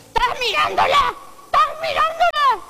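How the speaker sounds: high, loud jealous wife. Retold or imitated. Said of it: "y la mujer dice". (la esposa celosa, aguda y fuerte)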